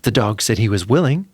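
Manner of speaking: usual speaking voice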